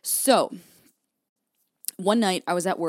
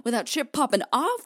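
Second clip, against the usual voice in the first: retold mad; 6 semitones higher, 4 dB louder than usual. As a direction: replay being mad, hammed-up. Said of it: "and I was mad".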